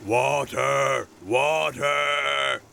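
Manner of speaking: loud, long-held character shout; mixer's big held-out call; drawn-out shout. A narrator's reading. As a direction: bellow it slowly like a cement mixer demanding water. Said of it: "shouted Max the mixer".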